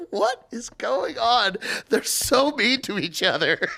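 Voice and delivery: high, slow incredulous wonder; bewildered. Retold or imitated. A customer baffled by the cooks' insults, high slow bewilderment. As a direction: gape at the chaos. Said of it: "And I'm like".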